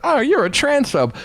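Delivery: high, slow mock scandalized voice; mock outraged accuser. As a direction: sneer it up high like a scold. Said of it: "they're like".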